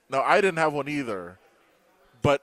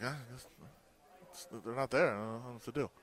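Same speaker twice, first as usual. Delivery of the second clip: much softer, defeated mumble; retold resigned reply; 9 dB quieter than usual. Coach gives up on finding an egg roll, quietly resigned. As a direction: let the words sink away in resignation.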